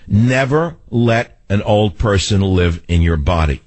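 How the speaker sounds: slow, word-by-word delivery; measured, weighty restatement of advice; slow emphatic repeat. Real, not acted. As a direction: spell out the motto deliberately, word by word.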